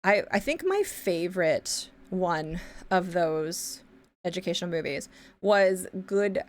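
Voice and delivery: ordinary delivery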